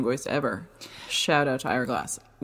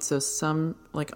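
usual speaking voice

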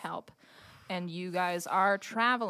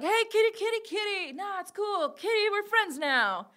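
much higher, placating falsetto; improv troupe coaxing a cat; 12 semitones higher than usual. Acted. Improv troupe making friends with a hissing cat, coaxing falsetto. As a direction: coo at a wary cat in a high voice. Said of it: "you see them like".

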